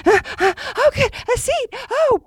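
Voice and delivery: high-pitched, flustered mimicry. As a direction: play a frazzled late passenger finding a seat. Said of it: "She's very flustered".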